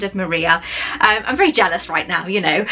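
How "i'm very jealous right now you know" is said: normal talking voice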